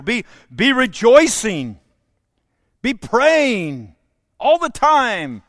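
loud, ringing pulpit voice; fiery preacher in full flow; preaching at full force. Acted. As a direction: proclaim it over the congregation.

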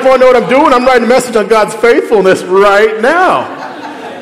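can't believe the luck, laugh it out; giddy gleeful reply; excited laughing. Retold or imitated. Surgery slot opens, elated.

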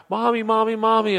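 high, insistent calling of mommy; a small child crying out for mom across the room. Retold or imitated. A child calls its mother over and over, high and insistent.

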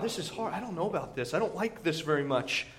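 hushed, grumbling murmur among the crowd. Retold or imitated. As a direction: mutter it low like a crowd complaining under its breath. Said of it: "you can hear them as it kind of makes or through the crowd".

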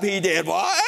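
loud disbelieving parent; mock shock. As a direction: parent hearing the pastor tied kids up. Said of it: "your parents are like".

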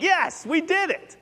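squealing slow victory cry; excited cheer. Retold or imitated. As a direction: crow over the win. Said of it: "I was so excited".

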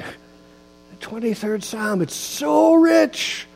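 "The twenty-third Psalm" said slowly, smiling, rising high on "it's so rich". Shown as a demonstration. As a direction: savour the words with a smile. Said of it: "you can't say it without smiling".

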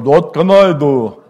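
slow, loud mock German accent; heavy, drawn-out German-accented impression. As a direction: draw it out like an old German uncle. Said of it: "in his German voice".

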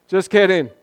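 teasing second just kidding. Shown as a demonstration. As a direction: bounce up on "kidding" to break the tension.

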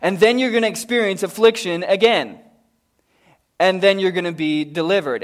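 loud, high, fervent preaching; preaching at full force. Acted. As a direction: drive the promise home to the church.

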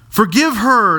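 ordinary talk, nothing marked

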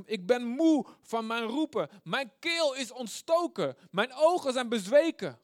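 high, loud, drawn-out cries; impassioned psalm outcry. Acted. Preacher crying psalm sixty-nine as desperate prayer, impassioned. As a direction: shout each phrase out, slowly.

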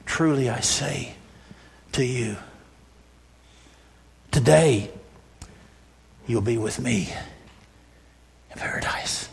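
hushed and tender, trailing off; Jesus answering the thief, soft and tender; quiet promise. Retold or imitated.

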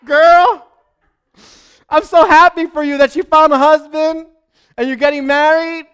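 very high, loud, gushing mock delight; shrieking fake excitement with a laugh; fake happiness. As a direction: overdo the joy for a friend's wedding. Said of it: "You're like".

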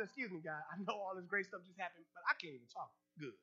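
a hint of meek Moses pleading; timid Moses. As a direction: mumble it like a nervous Moses. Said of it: "And then Moses".

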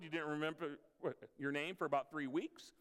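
normal talking voice

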